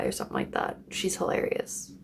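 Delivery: breathy whispered praise. Real, not acted. Fan praising comedian to viewers, whispered. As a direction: let it out as a whisper.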